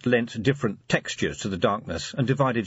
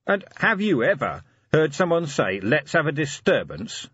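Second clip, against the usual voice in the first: higher, pointed question to a butler; lightly voiced Vimes, questioning; 8 semitones higher, 3 dB louder than usual. A narrator's reading. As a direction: ask it skeptically, pitched up.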